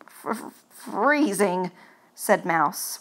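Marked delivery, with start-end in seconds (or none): 0.1-1.7 stammered shivering start, whispered f-sounds; chattering teeth. Acted. Mouse shivering with cold, stuttering freezing. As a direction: shiver through the first letters. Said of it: "with chattering teeth".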